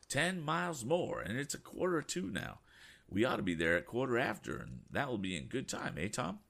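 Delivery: high, chatty voice for Mr. Damon; fussy old gentleman. Acted. Eccentric passenger checking his watch with Tom, high and fussy. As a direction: play the excitable older passenger.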